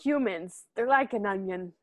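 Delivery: botched attempt at Shrek's accent; lightly put-on, off-target Shrek accent; failed Shrek accent. Friend quoting the onion line, bad Shrek accent. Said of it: "what that accent was supposed to be".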